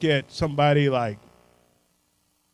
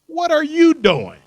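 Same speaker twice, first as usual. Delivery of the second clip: incredulous challenge; 12 semitones higher, 11 dB louder than usual. Acted. Challenging educators, mock outrage.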